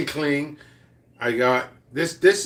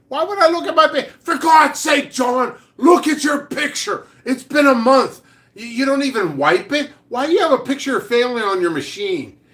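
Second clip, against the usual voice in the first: higher, louder, shouting at John; mock exasperated yell; 10 semitones higher, 4 dB louder than usual. Yelling at coworker John about his photo, exasperated shout. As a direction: bellow at him like he's blind.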